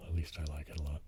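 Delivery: ordinary talk, nothing marked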